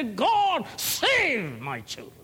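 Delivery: loud, high cry to God. Pretend. Parent crying to God for their children, loud plea. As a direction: call out desperately for your children. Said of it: "and say".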